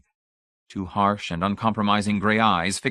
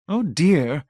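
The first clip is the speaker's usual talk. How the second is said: high, louder fluttery protest; mild protest; 8 semitones higher, 5 dB louder than usual. A narrator's reading. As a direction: tut like a flustered matron. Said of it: "Missus Pritchett protested".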